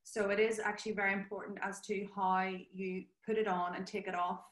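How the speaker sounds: usual speaking voice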